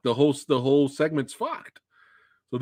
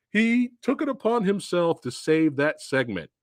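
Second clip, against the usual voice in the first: normal talking voice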